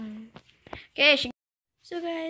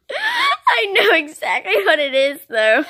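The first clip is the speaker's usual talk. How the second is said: laughing; 8 semitones higher, 11 dB louder, slower than usual. Real, not acted. Laughing guess.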